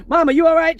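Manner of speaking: put-on high, loud kid call; mock worried child shout. Kids calling after crash.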